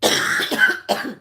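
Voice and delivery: harsh smoker's cough; coughing fit. Real, not acted. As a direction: cough hard before the word. Coughing fit while smoking.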